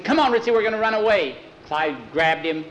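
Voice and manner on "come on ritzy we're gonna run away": put-on high, loud, fast boyish voice; urgent urging. Boy untying his dog to flee, urgent high voice. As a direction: urge the dog off quickly.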